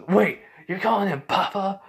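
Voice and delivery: high, loud disbelieving question; astonished. Acted. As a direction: blurt it out in disbelief. Carnage astonished at the name.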